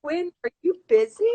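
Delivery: high, loud call toward the guest. Acted. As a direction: call him over brightly and fast. Calling out to the guest.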